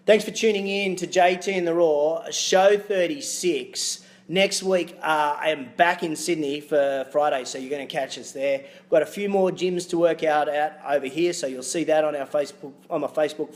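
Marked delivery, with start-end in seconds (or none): none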